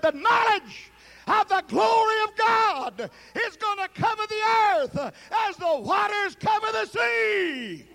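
high, loud preacher's cry of prophecy; booming proclamation. Acted. Preacher proclaiming to the congregation, booming loud. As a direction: proclaim it to the rafters.

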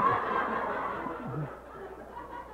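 breathy, unvoiced laughter trailing off; long fading laugh. Real, not acted. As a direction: laugh at your own punchline. Laughs at his own punchline.

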